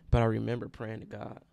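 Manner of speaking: ordinary delivery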